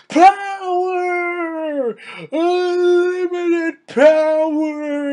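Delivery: very high, loud drawn-out villain scream; screamed Palpatine impression. Villain killing Mace Windu in a fiery blaze, screaming. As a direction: shriek it hugely over the top, stretching each word.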